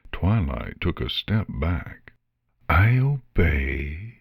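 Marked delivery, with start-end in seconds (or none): 2.7-4.2 slow sigh like wind through dead leaves; drawn-out, weary basilisk voice; sighing, rustling. A narrator's reading. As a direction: sigh it out in submission. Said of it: "sighed in a voice like the wind rustling dead leaves".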